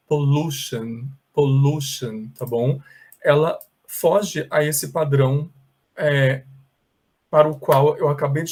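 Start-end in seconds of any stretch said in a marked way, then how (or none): 0.1-2.3 pollution said with a long oo sound; English pronunciation. Shown as a demonstration. Teacher modelling pollution for students. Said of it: "você vai pronunciar assim".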